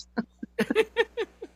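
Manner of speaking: giggling high; a quick high laugh; high giggle. Real, not acted. Laughing at own joke.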